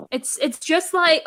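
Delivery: ordinary talk, nothing marked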